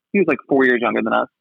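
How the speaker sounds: ordinary talk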